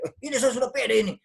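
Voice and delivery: high, quick retort; defensive protest. Acted. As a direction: fire back as if wrongly criticised. Acts out defensive reply to criticism.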